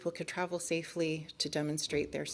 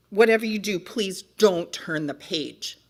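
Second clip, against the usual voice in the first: slowing right down on the request; emphatic plea; 2 semitones higher, 10 dB louder than usual. Acted. Book character warning the reader off the page, emphatic plea. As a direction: plead firmly, stretching out the words.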